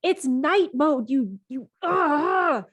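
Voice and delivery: huffy, stumbling protest; exasperated character. Acted. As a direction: break off into a frustrated groan. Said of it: "he points at it and he says".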